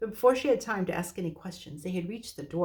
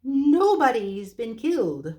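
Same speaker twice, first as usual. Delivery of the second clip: high, slow, loud hoot; hooted loudly; 5 semitones higher, 8 dB louder, slower than usual. Acted. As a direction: hoot the words at him. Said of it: "hooted the owl".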